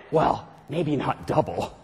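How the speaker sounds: low, slow little voice of doubt. Acted. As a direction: drop low and drag it out, doubtfully. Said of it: "the little voice that went".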